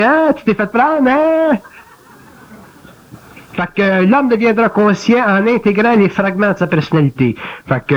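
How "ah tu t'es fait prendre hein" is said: high, gloating singsong taunt; mocking little voice. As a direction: crow over him like a smug inner critic. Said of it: "elle dit".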